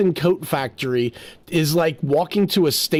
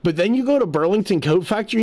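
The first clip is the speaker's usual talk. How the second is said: ordinary talk, nothing marked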